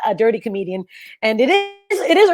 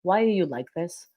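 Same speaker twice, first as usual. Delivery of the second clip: dead serious mom; 2 semitones lower, 8 dB quieter than usual. Retold or imitated. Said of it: "so serious".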